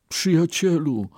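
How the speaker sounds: high-pitched exclamation; exclaiming Brahmin. A narrator's reading. Brahmin cries out to his ox friend, exclaiming. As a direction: cry it out high, then let it fall. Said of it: "wykrzyknął Bramin".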